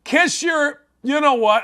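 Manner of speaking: ordinary delivery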